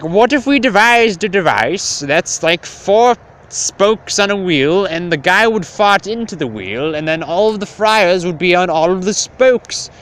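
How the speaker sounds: lightly voiced bright servant boy; nerdy inventor voice. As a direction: pitch it up like a clever kid explaining.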